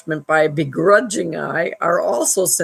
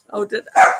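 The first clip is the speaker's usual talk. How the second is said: startled; 13 semitones higher than usual. Real, not acted. Caught off guard mid-broadcast.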